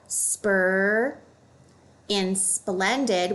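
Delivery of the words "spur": "spur" sounded out as the s-p-r blend. Shown as a demonstration. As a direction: say "spur" slowly, rising. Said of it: "we see the S P R".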